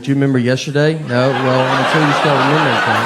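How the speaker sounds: mock interrogation. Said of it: "lock her in a closet and be like".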